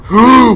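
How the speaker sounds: loud sharp gasp; startled gasp. Real, not acted. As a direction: gasp at a sudden scare. Monster reappears.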